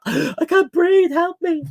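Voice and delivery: high, slow, gasping plea for air; hyperventilating. Pretend. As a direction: squeak like a suffocating toy figure. Voicing suffocating minifigures.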